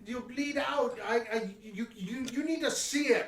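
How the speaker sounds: put-on high, pained child's plea; a wounded kid begging them to stop; the GM playing the injured girl, high and panicked; hurt little girl.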